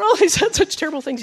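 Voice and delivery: high-pitched imitation of tearful complaint; playfully imitated upset wail; retold crying. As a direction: whimper it in mock distress, half laughing. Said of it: "and I was crying".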